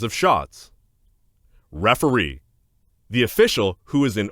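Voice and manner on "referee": usual speaking voice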